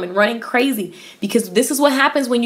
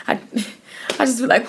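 laughing; 4 dB quieter, much faster than usual. Real, not acted. Laughing about getting emotional.

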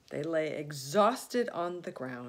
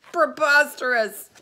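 laughing shout; 9 semitones higher, 17 dB louder than usual. Acted. Rhinoceros laughing at the name.

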